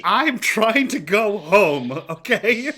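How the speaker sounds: loud, high, slow indignant protest; nerd rage. Real, not acted. Player defending a big dice roll, worked-up nerd rage. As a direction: insist on it with worked-up exasperation. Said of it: "He's got the nerd rage".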